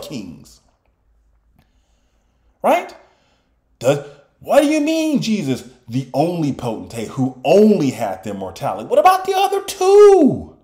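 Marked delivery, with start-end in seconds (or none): 2.6-5.2 much higher, incredulous mock questions at Jesus. 8.9-10.6 much higher, incredulous mock questions at Jesus.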